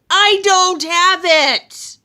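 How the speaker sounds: loud, high drawn-out shout; frustrated yell. Acted. Loki yells denial.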